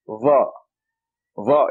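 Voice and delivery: Va with the tongue out, heavy Z sound; tongue sticking out. Shown as a demonstration. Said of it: "See my tongue?".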